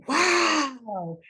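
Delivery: put-on high, amazed child's voice; wide-eyed kid asking about grandma; imagined grandchildren. Grandchildren marveling at grandma's work, high and amazed. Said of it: "I want them to say".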